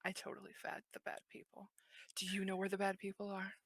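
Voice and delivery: very soft whisper. Acted. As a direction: lean in and whisper it. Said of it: "lean down and get really close".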